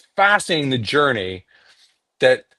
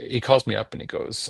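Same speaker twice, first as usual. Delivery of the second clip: usual speaking voice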